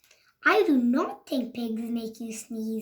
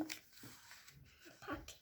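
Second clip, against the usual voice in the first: ordinary delivery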